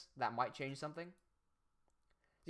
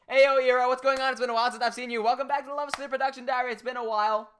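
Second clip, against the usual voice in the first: loud, higher, bright streamer welcome; hyped greeting; 7 semitones higher, 7 dB louder, faster than usual. Real, not acted. Streamer welcoming a returning viewer, loud and excited. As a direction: call out to the chat with energy.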